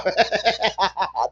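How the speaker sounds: high giggling laugh. Real, not acted. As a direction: crack up again. Laughs at chat comment.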